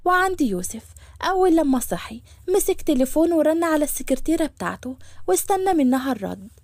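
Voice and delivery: ordinary delivery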